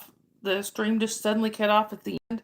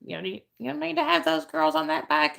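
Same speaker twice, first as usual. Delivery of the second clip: mom yelling; 7 semitones higher than usual. Retold or imitated. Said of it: "my mom being on the back porch yelling at him".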